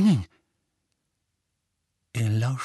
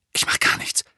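whisper. A narrator's reading. Harry hushing in the dark.

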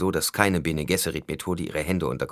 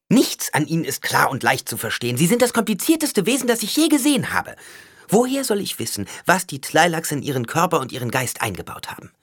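heated accusation; 11 semitones higher, 5 dB louder than usual. A narrator's reading. Alia wirft ihm Unklarheit vor.

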